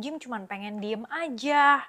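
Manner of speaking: usual speaking voice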